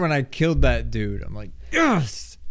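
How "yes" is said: mock triumph. Killing the boss.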